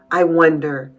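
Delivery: ordinary delivery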